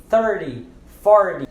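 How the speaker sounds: "forty", "thirty" with D in place of T. Shown as a demonstration. Teacher models numerals, "forty" and "thirty" with a D. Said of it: "Você vai pronunciar a letra D".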